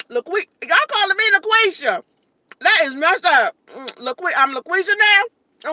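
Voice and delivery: loud, high, offended; mock offense at the name; indignant surprise. Real, not acted. Reacts to chat nickname.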